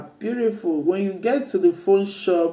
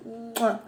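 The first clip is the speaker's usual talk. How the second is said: kiss sound; 4 semitones higher, 8 dB quieter than usual. Real, not acted. Kissing the new laptop.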